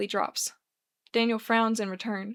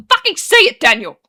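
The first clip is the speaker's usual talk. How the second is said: high, very loud outburst; furious shout; 6 semitones higher, 14 dB louder than usual. A narrator's reading. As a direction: explode at him, demanding he say it. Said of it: "Daniel roars".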